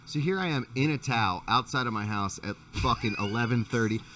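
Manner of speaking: ordinary talk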